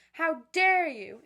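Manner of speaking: loud, high, drawn-out mock outrage; angry, stomach-supported. Shown as a demonstration. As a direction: project DARE from the belly without screaming. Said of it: "support with your stomach".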